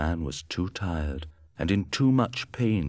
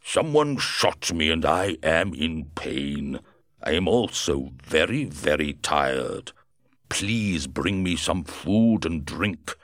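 wounded Griffin. Acted. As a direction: drag the words out in pain. Wounded Griffin asks for food.

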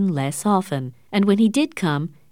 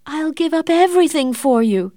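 high, louder, desperate offer; desperate exclaim; 10 semitones higher, 4 dB louder than usual. Acted. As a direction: throw everything at him to make him stay. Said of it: "Catherine exclaimed".

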